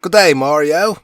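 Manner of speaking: loud, high Aussie greeting; hammed-up Australian accent, Crocodile Dundee style. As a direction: say it like a cheery Australian. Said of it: "can you do that with an Australian accent".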